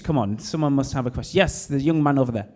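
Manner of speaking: ordinary talk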